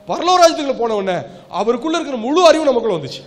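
loud, high-pitched preaching; predica a piena voce. Real, not acted. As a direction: raise the pitch and drive the point home to the congregation. Preaches at full force.

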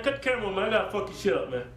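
ordinary talk

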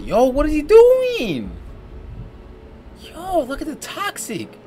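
high, slow, disbelieving; incredulous. Real, not acted. Vlogger watching a guy's wild behavior, high incredulous drawl. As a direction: stretch it out like you can't believe it.

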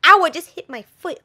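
sudden loud ouch; pained yelp. Real, not acted. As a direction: yelp from the stubbed foot. Hits foot mid-take.

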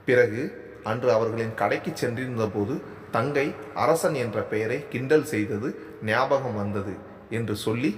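ordinary delivery

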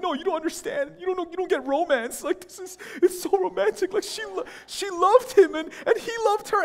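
very high-pitched pleading imitation; mock-swoon while defending a love story; gushing romantic friends. Friends voiced in acted argument.

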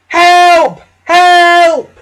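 very high, loud screams; crying out for help. A narrator's reading. James runaway with brakes on fire, screaming for help. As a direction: shriek in alarm, drawn-out cries. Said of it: "came through the yard crying".

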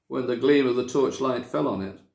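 ordinary delivery, nothing marked

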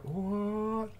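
high, drawn-out mock "what"; stunned reaction. Two friends see him return, stunned reaction. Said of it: "And they're like".

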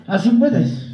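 usual speaking voice

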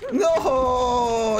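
long, high cry falling in pitch; despairing wail. Real, not acted. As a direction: wail it out in defeat. Dying in the game.

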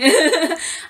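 short high giggle at herself; embarrassed laugh. Real, not acted. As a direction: laugh off the slip. Laughs at own stammer.

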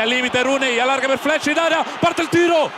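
high, loud building shout; excited shot call. Real, not acted.

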